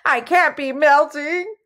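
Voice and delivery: high wail of disbelief; high-pitched, distressed villain; cried out. A narrator's reading. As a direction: wail in panicked denial. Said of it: "Antorel cried".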